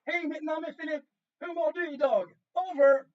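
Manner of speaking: clear, loud call, punching the sign-off; loud radio call demo. Shown as a demonstration. Teacher showing pupils the Swedish radio question, loud and clear. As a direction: project it as if to orbit. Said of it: "Kan du på svenska?".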